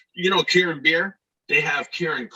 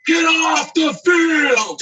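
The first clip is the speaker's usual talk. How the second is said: loud, very high, long-drawn holler; full-out groundskeeper shout; imitated yelling; 6 dB louder, much slower than usual. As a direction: bellow it slowly at the top of the voice. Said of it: "He's yelling".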